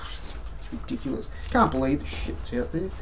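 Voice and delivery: whispered under the breath in disbelief; exasperated whisper. Real, not acted. Vlogger reacting to a school's complaint, exasperated whisper. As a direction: mutter it almost without voice. Exasperated aside after mock quote.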